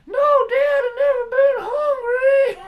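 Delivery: put-on very high, loud, slow wailing child voice; whiny kid. Son protesting to his father, a high fake-crying wail.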